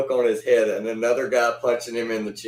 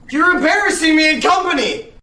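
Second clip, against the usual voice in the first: high, loud put-on cry of shame; a high, loud, hammed-up shout of embarrassment; mock outraged shout; 6 dB louder than usual. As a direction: shriek it like a scandalised aunt.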